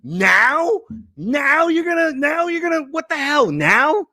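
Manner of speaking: high, incredulous repeated now; exasperated Kaepernick. Retold or imitated. Kaepernick mocking the late leagues, exasperated high. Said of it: "Kaepernick's like".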